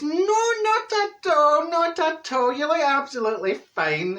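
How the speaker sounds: high, drawn-out gushing denial. Acted. Friend asked about the dress, over-reassuring. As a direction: protest far too much, pitch soaring.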